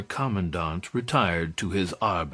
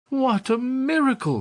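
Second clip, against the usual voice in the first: high, ringing cry of wonder; joyful cry. Acted. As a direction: cry out in joyous amazement. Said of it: "cried Candide".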